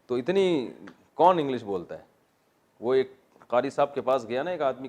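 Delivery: ordinary talk, nothing marked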